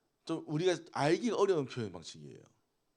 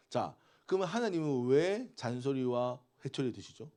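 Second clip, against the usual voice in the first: normal talking voice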